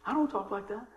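ordinary talk, nothing marked

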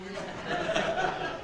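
high, soft, breathless laugh; helpless quiet wheeze of laughter; real wheezy laugh. Real, not acted. Laughs at own dentist joke.

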